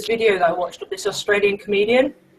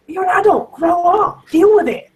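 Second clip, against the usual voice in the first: higher-pitched mock telling-off; exasperated scolding; 7 semitones higher, 4 dB louder than usual. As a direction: impatiently tell off a whiner, imitating a comedian. Quoting comedian scolding the easily offended.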